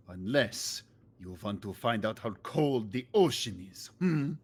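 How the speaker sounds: put-on lightly Swedish accented threat; a hint of a menacing Swedish sailor. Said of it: "I'm still doing Swedish".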